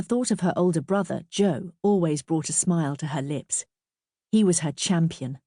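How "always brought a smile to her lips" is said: ordinary delivery, nothing marked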